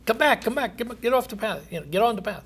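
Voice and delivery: soft, high coaxing plea; pleading call. Retold or imitated. God pleading with the straying sinner, soft and high. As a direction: call them back gently, like a worried father.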